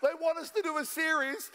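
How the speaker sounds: high, laughing through the words; laughing incredulity. Real, not acted. Pastor joking about rarely opened Bibles, laughing incredulously. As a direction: crack up while complaining.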